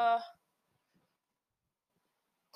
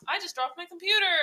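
sudden louder, higher blurt; startled; 7 semitones higher, 9 dB louder than usual. Real, not acted. Blurting to listeners that her computer just fell, startled. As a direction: caught off guard as the laptop hits the floor. Real mishap mid-recording, startled.

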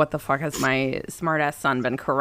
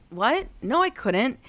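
incredulous; 7 semitones higher than usual. Retold or imitated. Mom incredulous.

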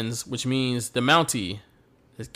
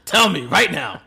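louder laughing Macho Man imitation; mock Macho Man; 3 semitones higher, 5 dB louder than usual. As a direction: growl it back like Randy Savage. Repeating Macho Man's line laughing.

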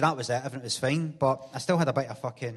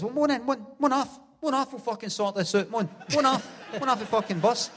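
put-on higher, swaggering drunk call-out; drunk challenge; 6 semitones higher than usual. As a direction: challenge them like a drunk hard man. Drunk challenging men to fight.

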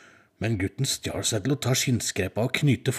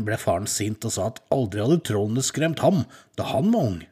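ordinary delivery, nothing marked

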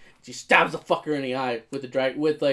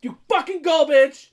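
much higher, loud excited cheering; cheering her on; 11 semitones higher, 4 dB louder than usual. Retold or imitated. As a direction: yell it like a fan in the stands. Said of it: "And I was like".

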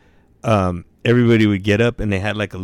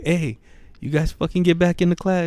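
teacher calling out; 9 semitones higher than usual. Retold or imitated. Teacher calling students back in.

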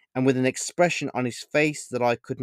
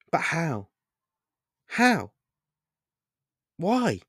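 higher, bewildered questions; stupefied; 6 semitones higher, 3 dB louder, slower than usual. A narrator's reading. Boy stupefied by the stranger's words, bewildered. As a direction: ask in disbelief, voice jumping up. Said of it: "completely stupefied by what the man".

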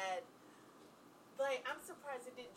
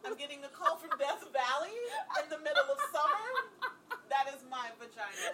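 laughing through it; 3 semitones higher, 5 dB louder than usual. Real, not acted.

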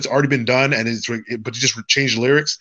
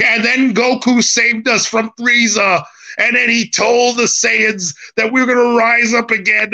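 mock preacher; 8 semitones higher, slower than usual. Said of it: "talking about Dragon Ball like the priest".

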